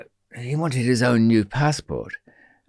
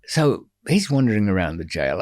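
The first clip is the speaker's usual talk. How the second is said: ordinary talk, nothing marked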